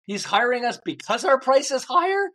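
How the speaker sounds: baffled inner thought, pitched high; what just happened, high and incredulous; bewildered disbelief. Retold or imitated. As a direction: voice jumps up high in stunned confusion. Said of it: "And I'm thinking".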